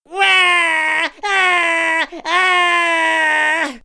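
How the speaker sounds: high, loud wailing screams; toddler scream. A narrator's reading. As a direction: wail like a child refusing the bath. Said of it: "screamed Lottie".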